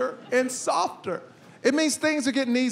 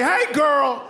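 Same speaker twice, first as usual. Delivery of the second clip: gleeful praise; much slower than usual. Acted. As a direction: congratulate the little troublemaker heartily. Praising daughter for hitting son.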